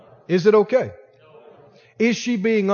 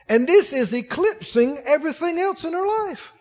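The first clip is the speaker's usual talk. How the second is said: usual speaking voice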